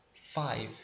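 Five ending on the v, no vowel; model five. Shown as a demonstration. Teacher modeling Five for students to repeat, clean ending. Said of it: "tenta comigo".

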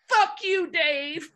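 high, loud mock-angry outburst; shouted comeback, pitch way up; indignant yell. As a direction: yell it back like a hurt kid. Insulted by his idol at bar.

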